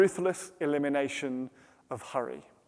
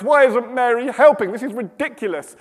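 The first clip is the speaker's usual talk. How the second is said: high, exasperated grumble; annoyed; 5 semitones higher, 5 dB louder than usual. Acted. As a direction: complain about a sister who won't help. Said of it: "getting more and more annoyed by this".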